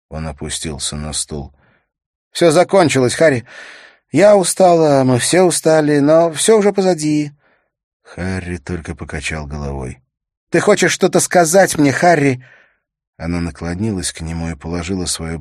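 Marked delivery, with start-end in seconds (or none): 2.3-7.3 high, loud female character voice; high-voiced woman telling him it's over; weary Liz. 10.5-12.4 high, loud female character voice; high-voiced woman telling him it's over; weary Liz. Same woman's voice questioning Harry.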